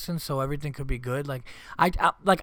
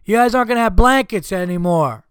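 raised, higher mock-threatening dad voice; stern dad threat; 7 semitones higher, 10 dB louder than usual. Dad warning his kids, raised stern voice. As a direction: lay down the law to the kids, loud.

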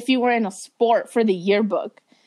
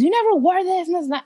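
a higher, nagging mom voice; imitating mom; 7 semitones higher, faster than usual. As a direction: lightly play a nagging mother.

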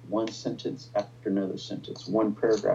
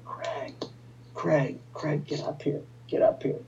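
higher, hushed imitation of an urgent call; imitated calling out; 6 semitones higher than usual. Wife summons husband Craig from upstairs, imitated urgent calling. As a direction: lightly mimic someone calling from upstairs. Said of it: "my wife came calling from upstairs".

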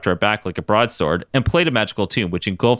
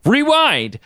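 shouted command; 3 dB louder than usual. A narrator's reading. Power command.

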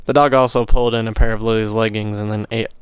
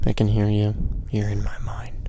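spooky whisper; 4 dB quieter than usual. Pretend.

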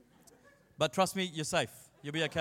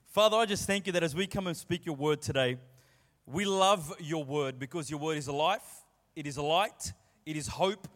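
opening prayer; 3 semitones lower than usual. Acted. Pastor prays before the message, earnest prayer voice. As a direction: pray over the church, steady and heartfelt.

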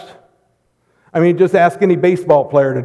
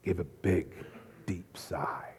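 whispered; 8 semitones lower, 18 dB quieter, slower than usual. Shown as a demonstration. Demonstrating the sigh.

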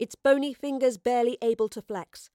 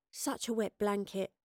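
muttered under breath; 6 dB quieter than usual. A narrator's reading. Astrid behind the tree, muttering about her companion. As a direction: mutter it as a private jibe. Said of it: "Astrid muttered under her breath".

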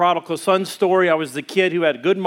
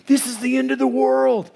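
pitched up, urgent imitation of panic; alarmed proclamation; 6 semitones higher than usual. Retelling his warning of the mark of the beast, alarmed. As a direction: cry it out as a retold warning. Quoted alarm at world's end.

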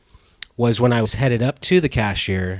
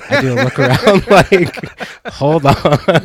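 laughing; 6 semitones higher, 7 dB louder than usual. Retold or imitated. Acting out a joke, laughing.

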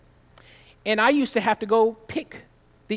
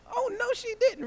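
much higher, hammed-up sassy outrage; sassier oh no. Preacher repeating his shocked reaction, hammed-up sassy. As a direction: push the scandalized voice way up.

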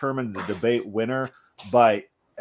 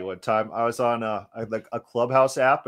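ordinary talk, nothing marked

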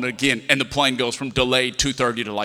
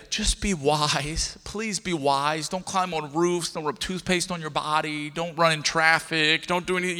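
pleading parent; 2 semitones higher than usual. Acted. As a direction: gently implore the kids, drawing words out.

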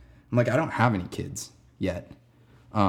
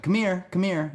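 higher-pitched imitation of a kid beckoning; 9 semitones higher than usual. Kid at summer camp calls his music teacher over, beckoning. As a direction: call someone over, higher, lightly voiced kid.

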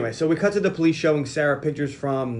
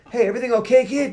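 higher-pitched imitated call to a kid; a hint of a concerned passer-by; lightly voiced bystander; 9 semitones higher, 3 dB louder, faster than usual. Two guys come over to the boy, lightly acted concern. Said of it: "the two guys come over".

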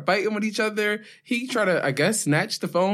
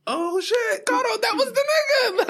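much higher, shrieking realization; retelling a shocked shriek to friends; excited shout; slower than usual. Retold or imitated. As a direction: squeal in shocked discovery. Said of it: "I was like".

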